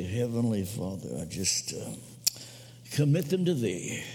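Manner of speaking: low, half-hearted mock prayer; insincere prayer. Mock prayer for an enemy, hoping God kills them, half-hearted. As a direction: mumble a dutiful prayer without meaning it. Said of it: "God didn't mean that you'd s-, just say".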